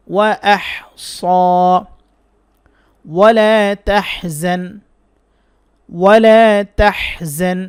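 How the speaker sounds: ইহসানা drawn out very slowly with a throat হ; Quran word recitation. Shown as a demonstration. Teacher demonstrating Quran words for students, slow recitation. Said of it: "উচ্চারণটা শিখেন".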